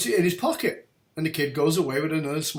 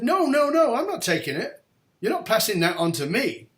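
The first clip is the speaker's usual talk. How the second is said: much higher, louder insistent no; loud refusal; 8 semitones higher, 6 dB louder than usual. Retold or imitated. Young man refuses the bishop's coin, loud and alarmed. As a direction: push the coin away, alarmed. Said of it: "And I said".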